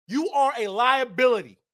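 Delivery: ordinary talk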